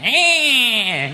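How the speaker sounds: high, drawn-out whine; horrible droning "eh". Shown as a demonstration. Comedian demonstrating his horrible voice, high drawn-out whine. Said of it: "the tone of my voice is horrible".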